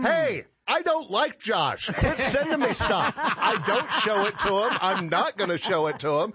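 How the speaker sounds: high-pitched, hammed-up exasperated rant; mock exasperation. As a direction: protest to the message senders, comically fed up. Acted exasperated reply to message senders.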